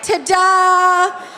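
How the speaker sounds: high, bright showbiz ta-da; mock ta-da. Joking about the stained dress, mock triumphant ta-da. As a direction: present the stain like a magic trick. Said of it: "I was like".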